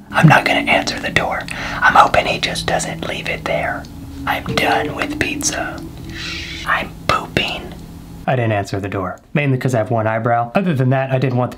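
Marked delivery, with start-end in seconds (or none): 0.1-7.7 hushed unvoiced whisper. Real, not acted. Pizza guy at the door, whispering to the viewers. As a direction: whisper so the delivery guy can't hear. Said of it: "I can't be too loud. The pizza guy will hear me".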